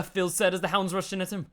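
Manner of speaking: ordinary delivery, nothing marked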